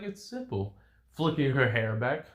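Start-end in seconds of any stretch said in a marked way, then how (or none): 0.0-0.8 high, soft, self-assured reply; poised female fighter. Acted. Woman fighter stops a kick to answer, poised and soft. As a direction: answer softly in a raised feminine voice.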